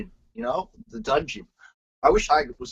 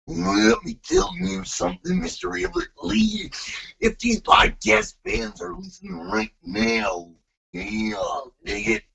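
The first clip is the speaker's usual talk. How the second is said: Macho Man Randy Savage; 4 semitones higher, slower than usual. Acted. Guest on request threatening the show's host, intense Macho Man impression. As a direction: stretch the words out into a strangled growl. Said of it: "do your Savage".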